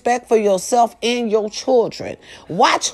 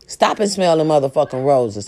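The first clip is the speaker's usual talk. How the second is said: usual speaking voice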